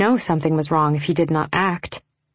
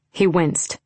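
plain reading voice

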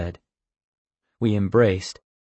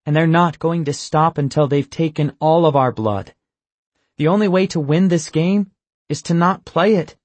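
higher and louder, pressing the point hard; impassioned plea; 8 semitones higher, 6 dB louder than usual. Acted. Ian urging Michael to come away, impassioned and loud. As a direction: push harder with every sentence. Ian passionately urges them to leave.

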